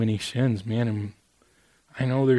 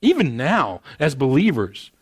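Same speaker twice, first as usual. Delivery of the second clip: full-force preaching; impassioned preaching; 3 semitones higher, 4 dB louder than usual. Real, not acted. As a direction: preach it with rising fervour.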